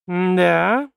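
drawn-out, rising doubtful question; disbelieving. Acted. Girl doubting her betrothed's denial, disbelieving. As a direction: stretch it upward in distrust. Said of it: "недоверчиво посмотрел на него суженый".

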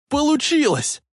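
very high, triumphant cry; joyful yell. A narrator's reading. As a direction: yell out the success. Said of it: "радостно завопил Марти". (ликующий вопль)

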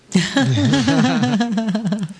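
bursts out in a high, loud laugh. Real, not acted. Laughing as he reads out his late friend's dedication.

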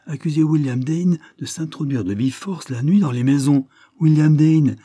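protest, building to an outcry; outraged protest. A narrator's reading. Lord Dane outraged at the accusation against William, indignant.

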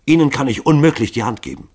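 high, loud, affronted refusal; outraged rejection; indignant refusal. A narrator's reading. Daughter refuses the killer's hand.

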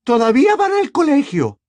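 high, loud objection; angry retort. A narrator's reading. Mother countering her husband about the twins, angry. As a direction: snap back high and loud.

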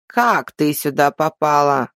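slow, detached elder woman; slow old woman voice. A narrator's reading. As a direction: ask it slowly and without emotion. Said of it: "поинтересовалась единственная женщина".

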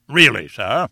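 loud indignant question; indignant cry. A narrator's reading. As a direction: bristle and cry it out. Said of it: "cried the professor".

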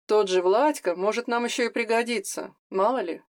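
ordinary delivery, nothing marked

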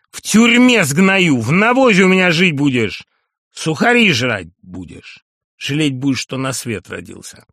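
furious shouted threats. Acted. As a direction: roar threats at him. Nunan beats and threatens Masul.

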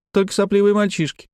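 put-on high-pitched childish put-down; scornful little girl. A narrator's reading. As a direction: declare it like a bratty child. Girl scorns boys' interest.

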